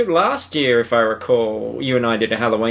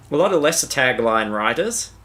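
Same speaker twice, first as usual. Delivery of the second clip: ordinary talk, nothing marked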